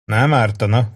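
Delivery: ordinary delivery, nothing marked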